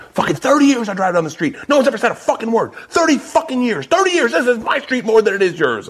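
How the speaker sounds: high, indignant mock rant; angry yelling. Older driver confronted for going the wrong way, yelling back. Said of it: "he starts yelling".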